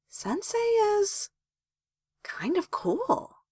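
very high, slow musing; high, soft, lingering thoughtfulness; thoughtful. A narrator's reading. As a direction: draw it out softly as if still deciding. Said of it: "Sakura said thoughtfully".

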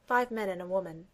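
usual speaking voice

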